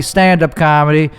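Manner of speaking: normal talking voice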